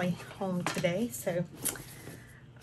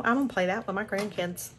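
ordinary talk, nothing marked

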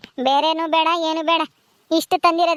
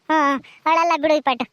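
usual speaking voice

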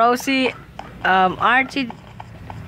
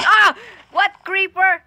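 high, loud startled cry; real high-pitched fright; startled yelp; 9 semitones higher, 4 dB louder than usual. Real, not acted. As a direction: yelp in sudden alarm at an attacker. Startled by creeper in game.

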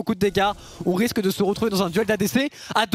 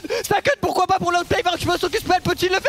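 screaming climax call, higher; esports caster; 8 semitones higher than usual. Acted. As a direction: explode as the outplay lands. (commentateur en pleine extase)

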